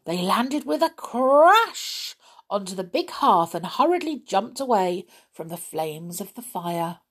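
plain reading voice

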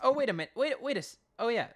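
a jolt of remembering mid-sentence; real surprise, high and loud; sudden realization. Real, not acted.

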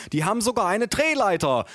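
high, excited grandma voice, acted; grandma calling out. A narrator's reading. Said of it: "rief meine Oma". (Oma, gespielt begeistert rufend)

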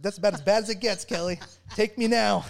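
high, loud laughing plea; cracking up while begging to be taken; laughing mock despair. Veteran jokes to Kelly about the stranded minivan, laughing out a high loud mock plea.

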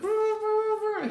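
very high, slow honking roo sounds; air horn imitation. Friend blasting an air horn at the narrator, honking roo imitation. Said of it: "he's got this air horn".

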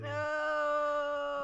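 long, loud, high wail of no; mock protest. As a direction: draw out a playful cry of refusal. Groans at the teased innuendo.

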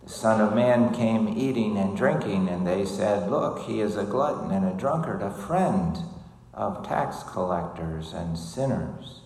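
loud, solemn gospel reading; gospel proclamation. Acted. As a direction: proclaim it to the whole church.

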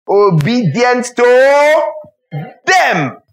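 "obedient to them" stretched slowly, pitched high; drawn-out emphasis. Real, not acted. Preacher telling congregation Jesus obeyed his parents, emphatic and drawn out. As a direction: hammer "obedient to" long and high, then land on "them". Preacher hammering home the key point.

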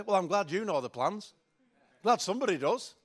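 mock-impressed, sarcastic jab. Man talking back to God about his plans, sarcastic. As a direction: say it with pointed sarcasm. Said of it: "I once said it sarcastically".

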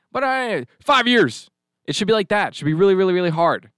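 loud, high gruff examiner; gravelly old tycoon. Pretend. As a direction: bark like a hard customs agent. Acting a harsh gun-permit examiner.

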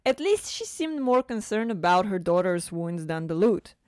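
normal talking voice

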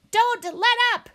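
high, loud owl shriek. A narrator's reading. Owl urging birds on against the robot, shrieking. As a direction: shriek to urge the troops on. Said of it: "shrieked the owl".

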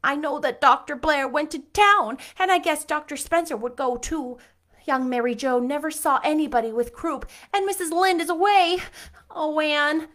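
high, loud distressed pleading; tearful. Acted. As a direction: keep the sob in her worried voice. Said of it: "sobbed Diana".

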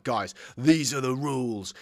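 slow, emphatic mock announcement; Mindy laying down rules. Film character addressing her friends about the rules, mock-declarative. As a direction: mimic someone solemnly declaring the rules, drawn out. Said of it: "Mindy's literally standing up like".

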